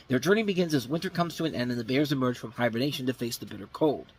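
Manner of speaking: ordinary talk, nothing marked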